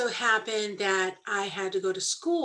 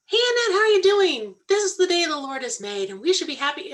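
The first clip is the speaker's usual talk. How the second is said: much higher, louder sing-song mock-cheer; chirpy greeting; 8 semitones higher, 5 dB louder, faster than usual. Imitating John's sunny hello to an angry Annette. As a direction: bounce it up high like an overly cheerful friend. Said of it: "he came up and he goes".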